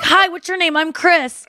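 sweet, polite. Retold or imitated. Boyfriend greeting a stripper he doesn't recognise, sweet and polite. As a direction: imitate a courteous, friendly hello. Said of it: "It's so sweet".

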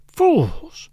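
high cry of despair, falling sharply; anguished exclamation. Acted. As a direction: cry out at your shared folly. Priest laments in mad misery.

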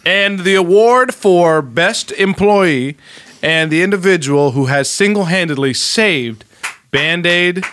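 slow, pompous presenter; slow award announcement. Acted. Host presenting an award to Mark, slow announcer voice. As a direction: announce the award with mock grandeur, slowly.

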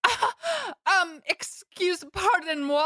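high, slow, flustered little voice; mock-scandalised squeak; flustered prude. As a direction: imitate Carrie clutching her pearls. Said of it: "and you're, like, terrified of the word?".

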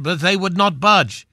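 usual speaking voice

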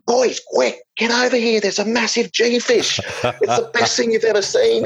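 very high-pitched mock urgency; imitated giddy alarm, voice way up high; hammed-up excited calling. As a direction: summon the mates in a squeaky, over-the-top frenzy. Brother calls his mates over.

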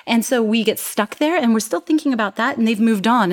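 ordinary delivery

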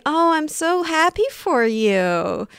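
forced cheerful congratulations; fake-nice, through a smile; fake happy. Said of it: "what my face would be saying would be".

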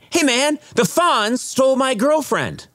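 very high, eager impersonation of an ex-actor; excited reminiscing. Retold or imitated. Delivery man recalling his sitcom part, mock excited. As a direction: pitch it way up, mimicking proud recall.

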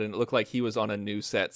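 normal talking voice

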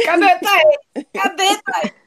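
calling out. Retold or imitated. Imagined friends calling for Thaís.

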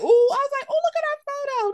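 squealing high and excited; high gushing voice. Retold or imitated. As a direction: gush like a giddy fan. Gushing over couple photo.

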